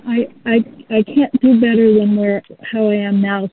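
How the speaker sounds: ordinary delivery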